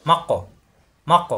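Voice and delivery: মাক্ক with a bounced ক; qalqalah bounce. Shown as a demonstration. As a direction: let the ক in মাক্ক echo briefly. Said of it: "বাড়ি খাবে".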